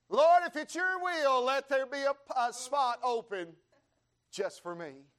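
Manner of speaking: comic supplication, loud then easing at the end; praying preacher. Acted. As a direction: plead earnestly with the Lord, hammed up. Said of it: "praying. He said".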